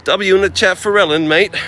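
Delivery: a cheeky Aussie shout-out to the chat; a light, hammed-up Australian twang; Aussie accent. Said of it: "Aussie accent".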